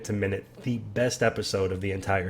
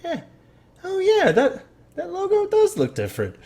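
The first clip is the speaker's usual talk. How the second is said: high, goofy voice agreeing; mock dopey agreement. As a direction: act like it just clicked, dim-witted. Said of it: "It's like".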